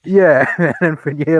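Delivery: usual speaking voice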